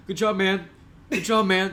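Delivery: lightly voiced coach praising his losing team; Dan Campbell impression. Podcaster imitating the Lions coach consoling players, lightly voiced coach. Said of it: "That's my Dan Campbell impression".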